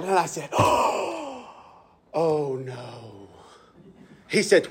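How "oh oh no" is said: mock dismay. Pastor teasing his friend, hushed mock horror. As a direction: pretend to be shocked, dropping quietly.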